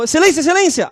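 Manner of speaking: intern bursting in, calling the judge loudly and high; urgent call. Retold or imitated. Intern rushing in, calling the judge loudly. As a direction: call out Excelência like an anxious intern.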